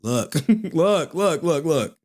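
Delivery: high voice cracking with laughter; laughing mock seller. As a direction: laugh through the sales pitch. Seller mocking crying fan.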